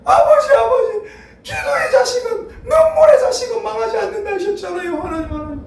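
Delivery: mother crying out a tearful prayer for her son; mother's tearful wailing prayer. Retold or imitated. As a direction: wail it like a weeping mother at dawn prayer. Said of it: "얼마나 우시는지".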